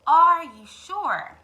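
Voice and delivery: loud, slow doubting duck. Acted. Duck doubts the hen's story. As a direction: draw out the doubt. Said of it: "asked Ducky Lucky".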